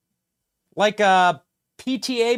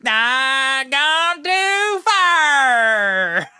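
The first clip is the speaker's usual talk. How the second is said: hammed-up Southern holler, dragged out slow, higher and louder; mock Southern drawl; 5 semitones higher, 5 dB louder, much slower than usual. Host mimicking the candidate's speech clip, in a mocking Southern drawl. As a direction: stretch every word in an exaggerated mock Southern accent. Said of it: "this is how Democrats talk".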